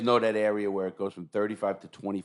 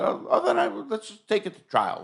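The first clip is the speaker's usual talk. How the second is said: mock offer; 12 semitones higher than usual. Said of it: "I said".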